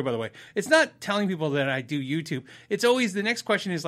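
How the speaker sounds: usual speaking voice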